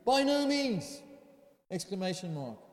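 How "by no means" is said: BY NO MEANS exclaimed high and loud; high, loud, absolute refusal; emphatic negation. Acted. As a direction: fire out "By no means" as a forceful exclamation.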